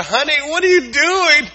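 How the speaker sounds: high, loud worried mom. Pretend. Mom checking on her child at breakfast, high worried voice. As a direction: call out like a startled mother.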